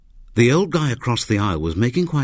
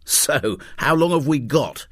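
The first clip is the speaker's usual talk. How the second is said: a tinge of belligerence, pitched up; belligerent demand; 7 semitones higher than usual. Acted. Alan pressing the scientist, with a tinge of belligerence. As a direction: push him for an answer, slightly combative. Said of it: "he demanded with a tinge of belligerence".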